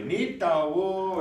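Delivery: "Nitau" high and loud; emphatic Grovan. Shown as a demonstration. Teacher demonstrating the emphatic word, loud. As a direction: punch "Nitau". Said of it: "So then you would say".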